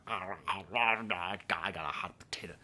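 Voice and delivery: slow muffled whisper of American speech; mock American mumble. Mimicking how Serbians hear Americans, slow muffled mock accent. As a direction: mumble "I got a hot potato" slowly as if mouth full. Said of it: "that's how Serbians think Americans talk".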